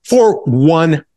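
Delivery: high, hyped TV pitch voice; infomercial. Acted. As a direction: close the sale like a TV ad. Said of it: "if you call within the next fifteen minutes".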